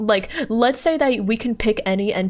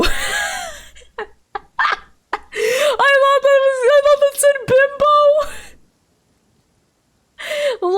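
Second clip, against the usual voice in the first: laughing; 5 dB louder, faster than usual. Real, not acted. Cracking up over misreading.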